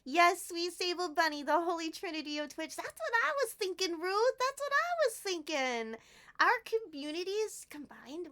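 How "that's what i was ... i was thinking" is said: ordinary talk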